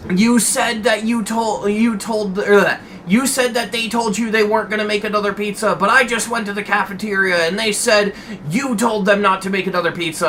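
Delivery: high-pitched imitation of a ranting boss; mock yelling. Boss confronting employee about the pizza, mock yell. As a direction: scold at a high pitch without real volume. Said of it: "he's fucking yelling like this".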